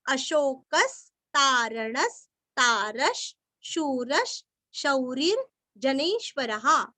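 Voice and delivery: visarga read as half श, the pieces said slowly; slow syllable-by-syllable drill; slow, broken-up practice reading. Shown as a demonstration. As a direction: read अशोकस्तारणस्तारश शूरश slowly, phrase by phrase. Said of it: "विसर्ग का उच्चारण आधा श".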